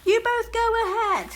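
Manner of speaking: put-on high, loud boy's call; Jack calling. A narrator's reading. Jack telling Matt to go ahead, calling loudly. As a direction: shout it back over the wind. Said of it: "said Jack".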